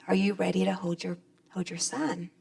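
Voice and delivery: soft unvoiced whisper. [name] gently asking the new mother to hold her son, whispered. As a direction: breathe it gently, barely voiced.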